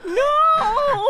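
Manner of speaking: high, loud cry of despair; anguished shout. Real, not acted. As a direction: wail out in dismay. Dramatic card reveal reaction.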